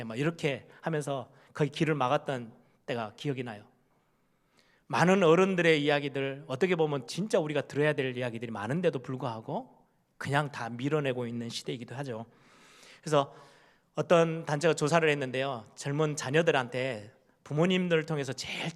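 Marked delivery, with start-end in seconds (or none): none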